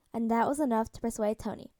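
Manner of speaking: usual speaking voice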